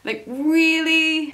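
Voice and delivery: slow, drawn-out disbelief; incredulous really. Real, not acted. Reviewer asking the filmmakers in slow disbelief. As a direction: stretch it out in disbelief.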